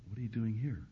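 very soft, tender question; beautiful gentle voice. Acted. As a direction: ask it quietly, like God waiting inside. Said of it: "in a beautiful voice he says".

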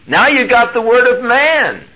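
high, loud sustained proclamation; preaching rebuke. Acted. God chides the preacher, loud preaching rebuke. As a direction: drive the rebuke home.

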